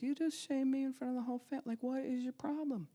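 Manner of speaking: a mock furious dad; angry father. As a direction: snap at the kid for embarrassing him. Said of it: "He's like".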